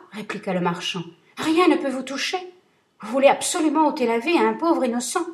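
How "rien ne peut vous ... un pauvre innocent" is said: higher, louder despairing plea; a desperate man begging the genie; pleading merchant. Acted. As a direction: cry out against his cruelty.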